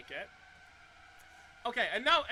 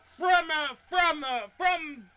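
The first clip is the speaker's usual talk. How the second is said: stumbling over words while excited; stuck repeating from, stammering; stuttering; 2 semitones higher, 6 dB louder, slower than usual. Real, not acted. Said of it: "okay, I'm stuttering too much".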